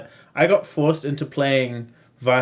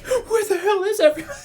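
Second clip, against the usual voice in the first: laughing. Retold or imitated. Mimicking lost friends, laughing.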